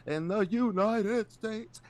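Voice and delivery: slow, high-pitched mock oratory; hammed-up high orator imitation; Tim Scott. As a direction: stretch it out like a grand speechmaker. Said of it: "Tim Scott at one point was like".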